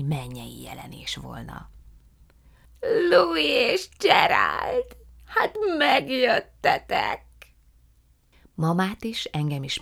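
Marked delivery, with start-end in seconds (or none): 3.1-7.2 put-on high, slow, drawn-out old lady voice; effusive old aunt. A narrator's reading. Aunt Fan welcoming the guests, slow and high. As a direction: stretch every word with delight.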